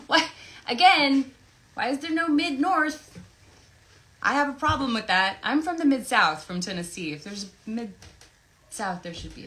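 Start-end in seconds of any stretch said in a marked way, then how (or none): 0.1-6.4 mock outrage. Mock outrage over region names.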